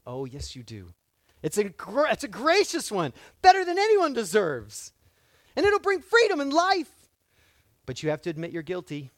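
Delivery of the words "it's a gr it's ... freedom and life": rising higher and louder, swelling into praise; preacher's fervent crescendo; building excitement. Real, not acted. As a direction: build it up till it rings.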